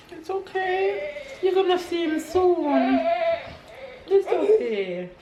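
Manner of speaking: high comforting words to a crying son; soothing. Real, not acted.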